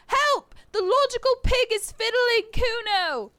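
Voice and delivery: high, loud fake cry for help; bratty kid yelling. Kuno yelling to passersby, high mock alarm. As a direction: shriek it like a brat faking distress.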